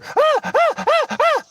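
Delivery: high-pitched mock "ah" moans; short, very high moans acting out foot-rub bliss; exaggerated squeals of delight; mock pleasure moans.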